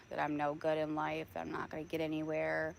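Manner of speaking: ordinary talk, nothing marked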